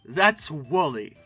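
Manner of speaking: ordinary talk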